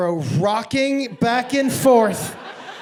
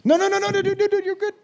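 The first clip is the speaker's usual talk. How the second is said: high, rapid-fire "no, no, no"; hasty reassurance; 10 semitones higher, faster than usual. Real, not acted. Comic to an audience member, high hasty reassurance. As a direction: squeal it to stop someone going.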